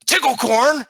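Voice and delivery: high, loud, rising kobold call; kobold's questioning cry. Acted. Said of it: "looks at Meepo and he goes".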